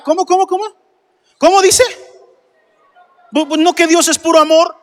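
high-pitched mock astonishment; feigned disbelief. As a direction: act baffled, as if you misheard. Feigns shock at God's anger. (incredulidad fingida, con voz aguda)

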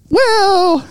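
high, teasing "well"; coy tease. Real, not acted. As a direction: tease it coyly. Teasing a clip.

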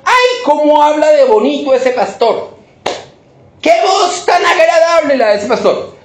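mock a churchgoer swooning over the pastor's voice; high, exaggerated, fawning praise; gushing churchgoer. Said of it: "No se quede simplemente diciendo".

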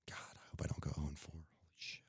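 hushed whispered curse to yourself; whispered dread. Real, not acted. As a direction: whisper it under your breath, barely voiced. Dreading an oh-and-four week.

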